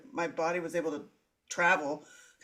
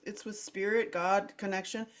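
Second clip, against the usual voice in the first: ordinary talk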